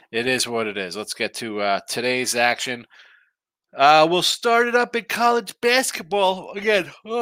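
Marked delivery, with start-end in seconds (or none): 3.8-7.2 talking through a real yawn, higher and louder; words stretched by a yawn; mid-yawn sleepy talk. Real, not acted. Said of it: "excuse me as I yawn".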